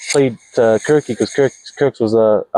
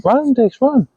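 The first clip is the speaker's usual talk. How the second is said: high, called-out yell; imitated sideline yell; 12 semitones higher, slower than usual. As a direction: shout it across the field. Coach yelling from sideline.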